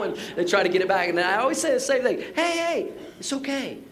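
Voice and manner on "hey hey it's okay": high, easy hey-hey, it's okay; reassuring. Retold or imitated. Pastor calming apologetic golfers, high light reassurance. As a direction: soothe someone who is over-apologizing.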